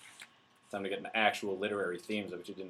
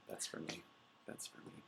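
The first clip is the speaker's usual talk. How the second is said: whispered aside; 4 semitones lower, 20 dB quieter than usual. Pretend. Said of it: "whispered aside".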